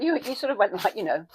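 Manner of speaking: normal talking voice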